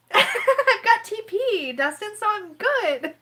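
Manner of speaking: high, very slow teary laugh; half laugh, half cry; laughing through tears. Real, not acted. Reassuring Dustin while crying and laughing.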